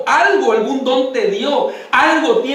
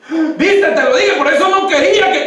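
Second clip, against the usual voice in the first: told-you-so king; 6 semitones higher, 5 dB louder than usual. Retold or imitated. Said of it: "king's told-you-so".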